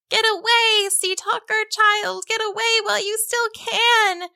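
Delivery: high, wailing cry of frightened fish. A narrator's reading. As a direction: wail the warning up high. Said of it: "Fish up and down the net wailed".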